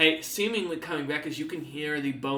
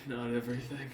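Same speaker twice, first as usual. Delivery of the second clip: quieter, lower, fading regret; softer sad echo; 3 semitones lower, 5 dB quieter than usual. Acted. Old man repeating it to himself, quieter and sadder. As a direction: let it trail off in resignation. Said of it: "sighs sadly as he looks down".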